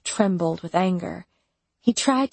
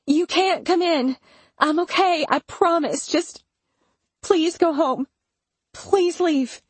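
desperate plea; 10 semitones higher than usual. A narrator's reading. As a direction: beg him to leave. Begging him to leave.

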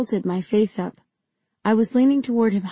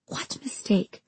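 soft, whispered question; barely above a whisper; 6 dB quieter than usual. A narrator's reading. Heroine questions the villainess, barely above a whisper. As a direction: ask it almost without voice. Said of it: "my voice barely above a whisper".

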